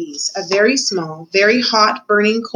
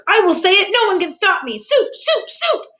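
defiant shout; 11 semitones higher than usual. A narrator's reading. Cook refusing to be silenced, shouting. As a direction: shout it defiantly, high and insistent. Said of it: "shouted Cook".

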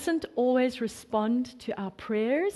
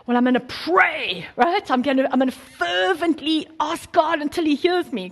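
higher, louder, hammed-up pleading prayer voice; mock fervent pray-er; 5 semitones higher, 5 dB louder than usual. Preacher mocking believers who beg God, hammed-up fervent pleading. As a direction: overdo the earnest begging, tongue in cheek. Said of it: "There's the sense of".

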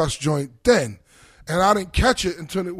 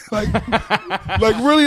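laughing; 5 semitones higher, 6 dB louder, much slower than usual. Real, not acted. Mocking [name]'s denial.

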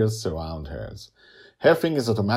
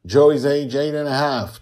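announcer; 8 semitones higher, 9 dB louder than usual. Acted. Announcing episode title.

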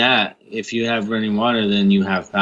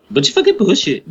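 higher, hammed-up disgusted repeat; mocking emphasis; 9 semitones higher than usual. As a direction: throw it back in a higher put-on voice.

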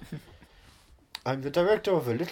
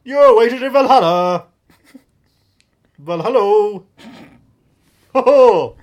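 hammy grand voice; 4 semitones higher, 9 dB louder than usual. Pretend.

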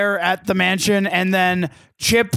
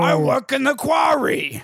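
Fred voice; 5 semitones higher, slower than usual. Retold or imitated.

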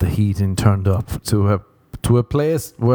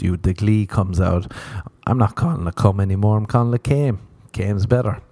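normal talking voice

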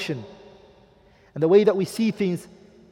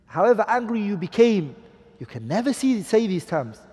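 normal talking voice

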